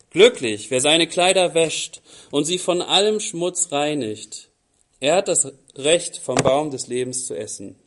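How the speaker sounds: loud, declaiming Bible reading; scripture reading. Acted. Preacher reading Revelation aloud to the church, loud and declaiming. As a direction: proclaim the text to the congregation. Reads Jesus' words from Revelation.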